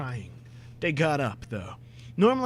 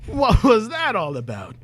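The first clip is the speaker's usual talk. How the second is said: high, loud indignant question; scandalised shout; outraged demand; 8 semitones higher, 8 dB louder than usual. Acted. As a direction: demand it in disbelief. Outraged demand after the photo.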